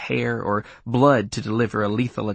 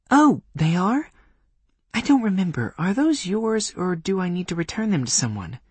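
put-on young woman voice; 9 semitones higher than usual. Voicing Granuaile.

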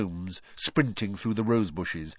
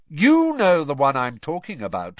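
louder and higher, pointedly meant to carry; slightly raised voice; 6 semitones higher, 8 dB louder than usual. A narrator's reading. Percy hinting to his father down the table, voice raised. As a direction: raise it so the whole table hears. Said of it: "He raised his voice slightly".

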